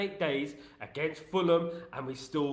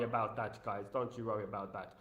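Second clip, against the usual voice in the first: soft reassurance; 6 semitones lower, 9 dB quieter than usual. Pretend. Soothes viewers while fixing setup.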